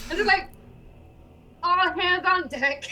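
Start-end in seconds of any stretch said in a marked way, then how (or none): 1.6-2.8 mock shout. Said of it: "you shout that".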